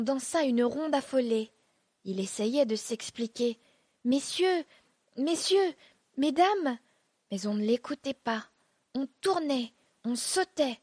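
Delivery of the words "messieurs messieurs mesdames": pleading to be heard. A narrator's reading. Tries to be heard over crowd.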